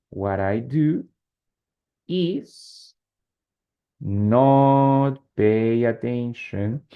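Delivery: slow, drawn-out chunks with long pauses; dictating. Shown as a demonstration. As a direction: build the sentence slowly, stretching the words. Teacher dictating example while writing it.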